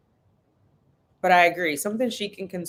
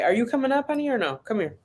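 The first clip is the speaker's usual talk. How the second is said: higher, gentle asking; 6 semitones higher, faster than usual. Real, not acted. Mom asking her daughter to come up, gentle. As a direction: sweetly call her over.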